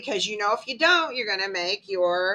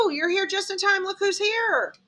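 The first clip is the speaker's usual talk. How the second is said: high, gushing mom welcome; delighted hostess; 10 semitones higher than usual. Acted. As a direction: show off a guest in a high voice. Mother excitedly presents a guest.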